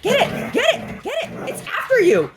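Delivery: egging on the dog. Real, not acted. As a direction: cheer the dog on.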